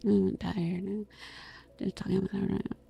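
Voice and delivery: worn-out, hushed chatting; soft, tired talk. Shown as a demonstration. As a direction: talk really softly, tired and calm. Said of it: "I was talking really softly".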